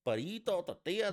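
high, loud mock-Spanish food words; Spanish accent. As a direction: put on a big Spanish accent. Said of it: "have an accent the whole time".

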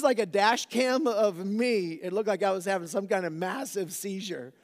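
breathless laughing retelling; laughing excitement. Real, not acted. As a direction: build up the wild skid while laughing.

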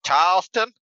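"Charleston" in a Boston accent, the r dropped, pitched high and loud. Shown as a demonstration. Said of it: "in Boston, it'd be like".

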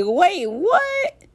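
sudden high disbelief; surprised. Retold or imitated. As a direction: caught off guard by the numbers. Surprised at the countries count.

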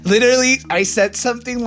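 high, loud literally; emphatic. Real, not acted. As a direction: punch the word Literally. Emphatic aside.

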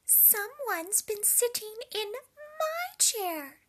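a prim, shocked mama bear. A narrator's reading. Mama Bear finding her chair used, high slow hushed shock. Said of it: "said Mama Bear".

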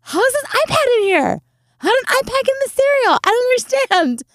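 very high, bewildered squeal; baffled surprise. Retold or imitated. Asking the coworker how the iPad got in, high and baffled. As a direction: sound utterly confused and delighted.

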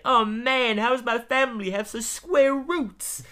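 high, slow dismayed hipster; beatnik dad voice. Retold or imitated. Ned's dad lamenting his square family, slow and high. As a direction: groan it like a cool dad let down.

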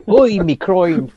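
an Irishman just hit in the balls; pained Irish accent. Acted. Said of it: "Reminds me of an Irishman being hit in the balls".

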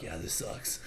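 low, soft whispered deadpan. Retold or imitated. Solid reacting to the sad scene, whispered deadpan. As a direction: mutter it like you're done with all this. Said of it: "Solid's like".

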